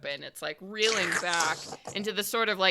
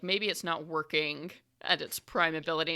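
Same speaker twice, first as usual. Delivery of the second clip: ordinary talk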